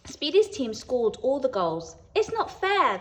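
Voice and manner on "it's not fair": a high, loud protest; shouted. A narrator's reading. Spiders on the losing team shouting at the others, loud and high. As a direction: cry out that it's unfair. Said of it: "The spiders on Scrabble's team shouted".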